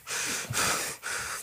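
long breathless sigh; worn-out sigh after practice; heavy exhausted sigh. Retold or imitated. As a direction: let out a drained sigh. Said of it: "I was like".

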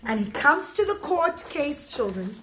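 ordinary delivery, nothing marked

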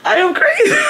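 high laughing self-mockery. Real, not acted. Laughing at herself.

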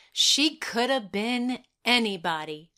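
ordinary delivery

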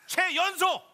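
high shouted summons; showman call. Acted. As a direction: call the guest onstage like a showman. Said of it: "나오시오".

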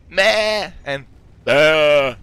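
slow, loud bleating of meh and bah; drawn-out barnyard bleats; goat and sheep bleats. Shown as a demonstration.

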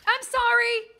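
high, loud mock apology; apologetic cry. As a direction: wail an apology to your prey. Calls out apology to hunted bunny.

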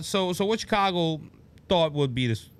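ordinary talk, nothing marked

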